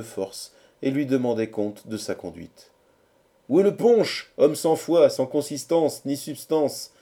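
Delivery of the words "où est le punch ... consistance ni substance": raised, high voice of rowdy friends; chorus of friends. A narrator's reading. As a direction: demand it loudly, as a whole band of friends. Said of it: "dit le chœur des amis". (chœur d'amis qui interpelle haut et fort)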